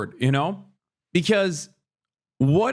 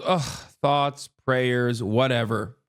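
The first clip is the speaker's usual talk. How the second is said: dismissive mock sigh; 8 semitones lower, slower than usual. Mocking bored public reaction.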